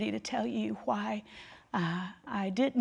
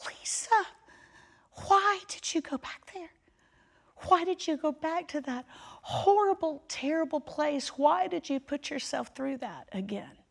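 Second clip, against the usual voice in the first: much higher, anguished auntie; high, pained scolding with a whispered start; aunt's dismayed voice; 8 semitones higher than usual. Retold or imitated. Aunt Darlene to grieving Lisa, high and dismayed. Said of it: "my Aunt Darlene said".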